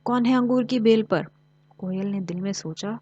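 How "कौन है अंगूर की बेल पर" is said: raised voice calling up to the vine; loud call. A narrator's reading. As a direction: call up loudly to whoever hides there. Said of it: "फिर बुलंद आवाज़ से बोली". (लोमड़ी बेल पर छिपे परिंदे को ऊँची आवाज़ में पुकारती है)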